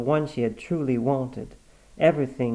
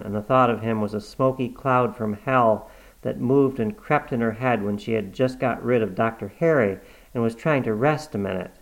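plain reading voice

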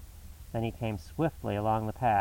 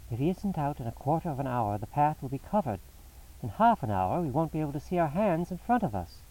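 higher-pitched, urgent Holmes; impatient; 5 semitones higher than usual. A narrator's reading. Holmes watching the fog close over the path, impatient. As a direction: let impatience push the pitch up. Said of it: "stamped his feet in his impatience".

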